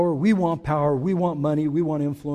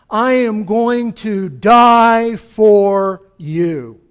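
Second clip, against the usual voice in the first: slow, louder and solemn; solemn voice of Jesus; 2 semitones higher, 9 dB louder, much slower than usual. Acted. Preacher voicing Jesus to the congregation, slow and solemn. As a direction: lay each word down like a vow.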